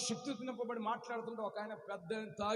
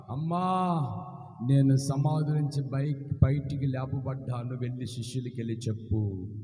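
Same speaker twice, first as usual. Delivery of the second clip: gentle Jesus voice; 9 semitones lower, 5 dB louder than usual. Acted.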